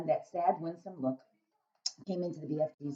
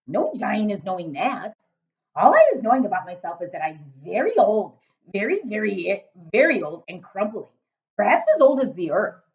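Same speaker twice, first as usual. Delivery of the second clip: loud, booming friendly giant; a big-hearted giant rumbling away; 4 semitones higher, 12 dB louder than usual. Acted. BFG speaking.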